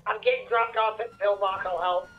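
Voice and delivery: radio voice. Acted. Police radio call.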